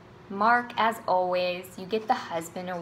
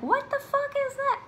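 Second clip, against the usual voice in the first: much higher, indignant what the fuck; incredulous outburst. Real, not acted. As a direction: squeal it in disbelief. Outraged at bad sportsmanship.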